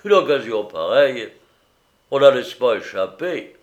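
loud, emphatic self-congratulation; self-satisfied merchant, punching it out; gloating. A narrator's reading. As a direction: crow over a bargain. Merchant gloats over his bargain.

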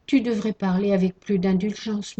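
plain reading voice

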